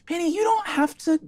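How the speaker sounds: lightly voiced Zelda, high and gentle; a hint of a caring friend; Zelda in character. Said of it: "holds you close and says".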